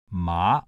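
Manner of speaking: "ma" starting low and rising; Mandarin second tone. Shown as a demonstration. Lecturer demonstrating Mandarin tone number two, rising "ma". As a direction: lift "ma" upward from a low start. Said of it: "Mandarin tone number two".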